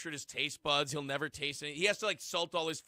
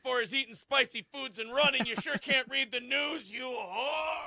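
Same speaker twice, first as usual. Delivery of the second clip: higher, slower mock rant; 6 semitones higher, 4 dB louder, slower than usual. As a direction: rant it with hammed-up scorn. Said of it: "He's like".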